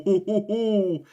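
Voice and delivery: drawn-out hoo sounds after a miss; near-miss hoots. Real, not acted. Streamer groaning as the shot goes wide. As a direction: let out slow hoots at the miss.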